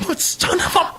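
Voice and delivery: imitating his own convention-floor outburst; retold scream. Fan retelling his scream at the convention center, high mock yell. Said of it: "just screaming at the top of my lungs".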